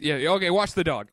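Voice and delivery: lightly voiced dismissive brush-off; tossed-off "watch the dog."; quoting the line from the show; mimicking Hope. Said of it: "I like that she's just".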